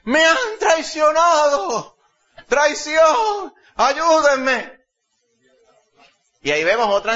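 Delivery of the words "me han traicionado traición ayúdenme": loud, high, slow theatrical wail for help; loud high melodramatic cries, half laughing; hysterical queen. Acted. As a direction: overplay the betrayed queen tearing her robes. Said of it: "Rasgó sus vestidos. ... el show que formó".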